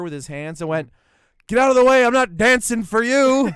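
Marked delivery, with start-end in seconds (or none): 1.5-3.5 high, very loud yell, stretched at the end; big theatrical bellow to clear the way; mock commanding shout. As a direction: holler it over a crowd, hammed-up. Retold shout at crowd while dancing.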